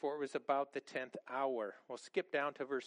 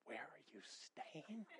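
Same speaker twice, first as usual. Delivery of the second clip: much softer, laughing disbelief at "Where are you staying?"; incredulous echo; 18 dB quieter, slower than usual. Retold or imitated. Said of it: "the other disciple looking at him going".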